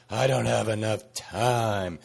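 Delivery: slow whiny complaint; slow, whiny mock grumble; complainy pants voice. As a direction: drag it out like a moaning complainer. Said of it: "that's my complainy pants voice".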